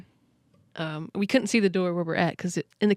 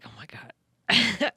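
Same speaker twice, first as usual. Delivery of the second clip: slow breathless whisper, higher; very slow starstruck whisper; awed whisper; 4 semitones higher, much slower than usual. Retold or imitated. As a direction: barely breathe it out, still stunned. Awestruck after idol leaves.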